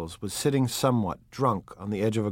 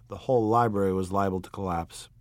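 ordinary delivery

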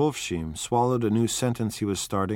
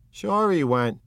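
Wolfsheim; 8 semitones higher, 5 dB louder, slower than usual. Acted. Wolfsheim answers.

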